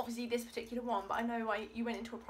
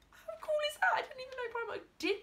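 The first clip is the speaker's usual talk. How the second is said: excited squeal; 14 semitones higher, 4 dB louder, faster than usual. Real, not acted. Delighted at face mask.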